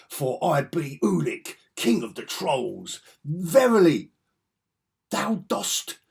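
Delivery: slow, grandly declaimed comic-book villain; loud, drawn-out olde-worlde proclamation; mock-archaic troll king. Comic fan hamming up troll king Ulik's speech, grandiose. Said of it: "makes you want to talk like that".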